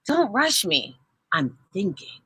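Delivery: soft, absorbed, don't rush me; quiet concentrating kid; focused murmur. A narrator's reading. Jace voiced, focused.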